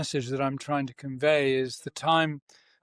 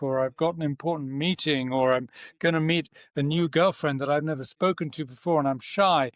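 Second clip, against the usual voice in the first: usual speaking voice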